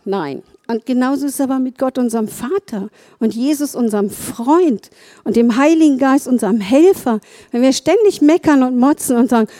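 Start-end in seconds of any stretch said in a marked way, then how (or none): none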